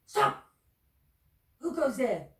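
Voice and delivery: loud, sharp guard shout; guard's challenge. Acted. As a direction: call out to halt them.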